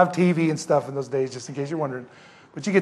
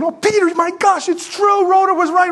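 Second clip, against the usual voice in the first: high, loud amazed exclaiming; excited uproar; 13 semitones higher, 5 dB louder than usual. Acted. The whole group greets Peter at the door, excited high clamour. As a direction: gush all at once in astonished joy. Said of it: "they're all just going".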